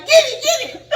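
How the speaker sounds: high, loud, laughing panic; frantic shout. Retold or imitated. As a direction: yell at him to grab it. Urging him to catch frog.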